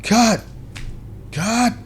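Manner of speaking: high-pitched call, as from across a set; high, short shout of cut at modest volume; director calling cut. Retold or imitated. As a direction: imitate a director yelling cut. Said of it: "all I heard was".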